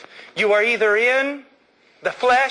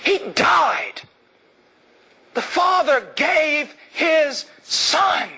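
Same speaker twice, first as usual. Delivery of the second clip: raised, impassioned declaration; 6 semitones higher than usual. Acted. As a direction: build to slow, high, ringing cries.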